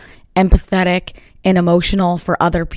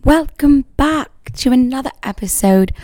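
higher put-on British accent on "Welcome back to another episode"; playful British-accented welcome; 6 semitones higher than usual. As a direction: open the show in a mock British accent. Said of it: "my British accent this morning".

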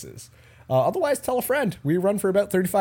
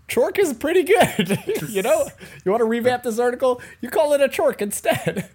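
laughing; 8 semitones higher than usual. Real, not acted. Laughing while mocking the article.

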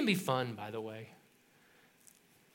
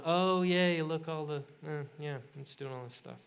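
sarcastic fading yay; 3 dB quieter than usual. Retold or imitated.

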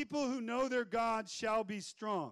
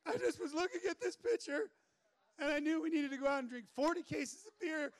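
high, put-on lovelorn voice; hammed-up pleading of an old flame; pickup-truck ex; 6 semitones higher than usual. Old flame in the truck with her picture, high mock pleading. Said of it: "Just like".

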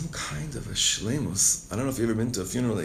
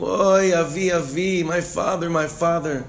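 mock grieving cry, higher and louder; crying out for father; 6 semitones higher, 4 dB louder than usual. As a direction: cry out to a dead father, lightly acted.

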